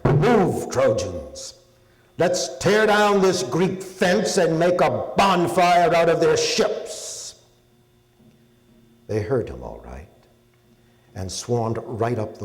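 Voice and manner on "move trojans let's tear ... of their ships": loud, high war cry, slow and stretched; battle shout. Acted. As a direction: split the air with a rallying yell. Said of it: "His shout split the air".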